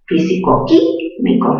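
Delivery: físico químico joined, high QUÍ in químico; stress on QUÍ. Shown as a demonstration. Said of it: "En la sílaba qui". (físico químico con mayor fuerza en QUÍ)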